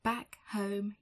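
ordinary delivery

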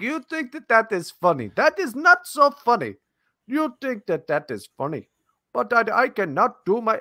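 badly faked Indian accent, mock offended. Host does a bit as the reply guy in an Indian accent. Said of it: "almost do my Indian accent today".

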